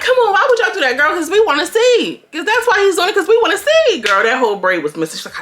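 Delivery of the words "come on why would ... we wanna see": high-pitched whiny complaint; Bree complaining. Retold or imitated. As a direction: whine it out like Bree scolding. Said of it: "Bree like".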